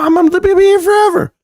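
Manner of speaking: high excited cry. Real, not acted. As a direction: shout it out with glee. Excited outburst about holding shares.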